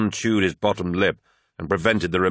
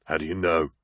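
voice close to breaking. A narrator's reading. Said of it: "his voice close to breaking".

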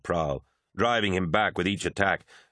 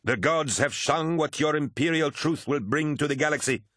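high-pitched zealous cry; shrieked outburst; 8 semitones higher than usual. A narrator's reading. Prahl prophesies doom to Lucius, high disdainful shriek. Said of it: "shrieked Prahl".

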